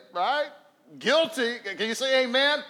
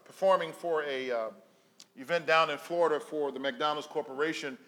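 normal talking voice

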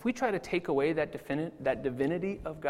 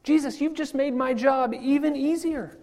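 relieved wonder; 8 semitones higher, 4 dB louder than usual. Retold or imitated. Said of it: "I say".

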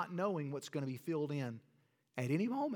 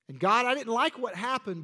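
raised, pleading cry to God; emphatic prayer; 6 semitones higher, 7 dB louder than usual. Pretend. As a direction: plead loudly with God, high and strong. Prayer voiced to God in sermon.

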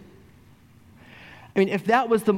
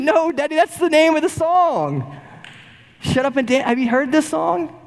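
put-on much higher, lively little girl explaining; child voice; 12 semitones higher than usual. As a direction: squeak it out like a small daughter correcting her dad. Daughter voiced in a story.

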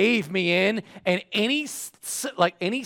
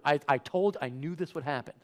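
normal talking voice

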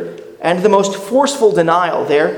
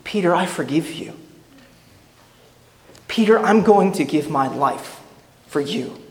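tender forgiveness; 4 dB quieter than usual. Pretend. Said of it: "Could that look have communicated".

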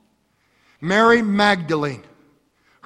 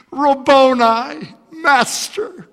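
Mary's joyful cry; 8 semitones higher, 6 dB louder, slower than usual. Acted. Mary recognizing Jesus.

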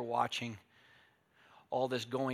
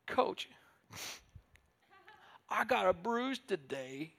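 whiny player; 7 semitones higher, slower than usual. Retold or imitated. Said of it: "football player coming to the coach".

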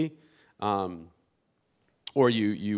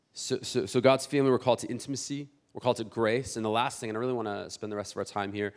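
usual speaking voice

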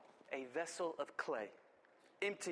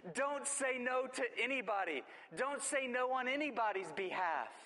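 preaching; 7 semitones higher than usual. Real, not acted. Pastor urging the church not to refuse for others, fervent preaching. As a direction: drive it home like a revival preacher.

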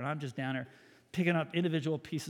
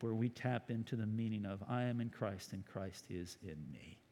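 closing prayer; 3 semitones lower than usual. Acted. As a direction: pray reverently, fading at the end.

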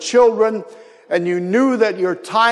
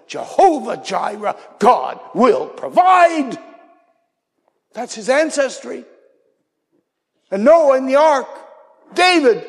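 preaching at full force; 5 semitones higher than usual. Acted. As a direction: build the story to a rousing peak. Abraham story at full force.

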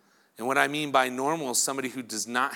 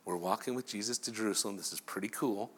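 soft, hushed golf gallery; hushed crowd; 6 semitones lower, 9 dB quieter than usual. Retold or imitated. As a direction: hush like fans following a star. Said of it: "It's kind of a golfing event".

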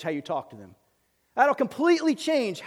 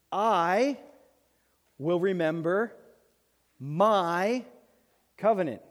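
slow reading; much slower than usual. Shown as a demonstration. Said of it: "read it slowly".

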